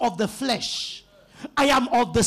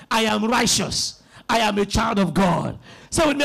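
ordinary talk, nothing marked

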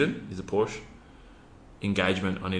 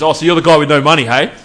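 loud, fast, higher, laughing jeer; mocking loud call; 8 semitones higher, 16 dB louder than usual. Salesman teasing him in front of the yard, loud laughing jeer. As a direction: announce it to the whole yard with a laugh.

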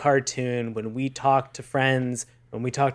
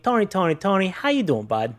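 put-on Brooklyn accent greeting; New York accent; 6 semitones higher than usual. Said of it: "to straight up New York Brooklyn like that".

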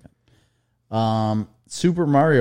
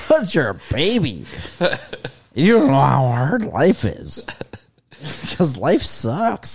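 mock crying dad; 4 semitones higher than usual. Mock dad sobbing to kids.

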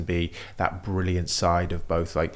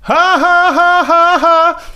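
forced high cartoonish laugh; loud strained fake laugh; Tidus laugh; 14 dB louder, much slower than usual. Shown as a demonstration. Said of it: "I was gonna ask you how your laugh is, your Tidus".

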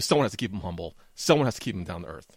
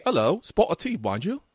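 mock British accent, Hello pitched up; 7 semitones higher than usual. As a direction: ham up a British tea-time accent. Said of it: "that's better".

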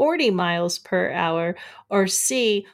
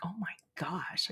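hushed whisper of amazement; whispered awe; 2 semitones lower, 14 dB quieter than usual. Retold or imitated. Host retelling her reaction to a salty snack, whispered. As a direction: breathe it in awe. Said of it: "I'm like".

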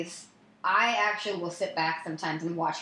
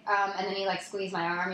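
normal talking voice

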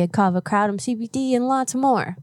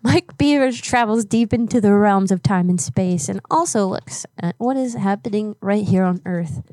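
normal talking voice

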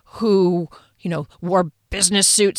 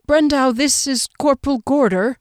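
slightly uneasy, higher introduction; tremor in voice; 6 semitones higher, 3 dB louder than usual. A narrator's reading. Sandra presents the corporal to Brendau, mildly nervous. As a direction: introduce them with a touch of nerves. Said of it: "a tremor in her voice".

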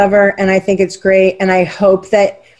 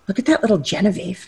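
soft, quick aside to a husband; half-whispered, hurried remark; retold whisper; 7 dB quieter, faster than usual. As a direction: imitate a hushed whisper, lightly voiced. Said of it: "I'll whisper to my husband".

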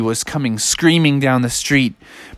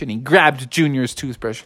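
ordinary delivery, nothing marked

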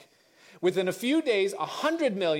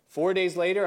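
ordinary talk, nothing marked

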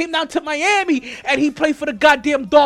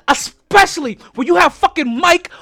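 real fury spilling into a shout; furious rant; 3 semitones higher, 7 dB louder than usual. Real, not acted. Jets fan yelling at his team's coaching hire, furious. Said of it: "I am shaking".

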